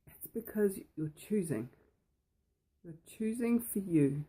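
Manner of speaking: ordinary talk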